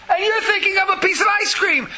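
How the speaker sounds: high, loud exclamation of disbelief; indignant outburst. Real, not acted. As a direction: cry out at how wrong that is. Speaker's outburst at the parent.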